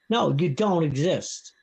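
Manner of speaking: ordinary talk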